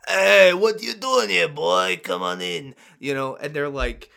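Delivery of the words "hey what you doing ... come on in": loud, gross Brooklyn barkeep; hammy, grungy Brooklyn character voice; grungy Brooklyn accent. Acted. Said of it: "Gross, grungy Brooklyn accent".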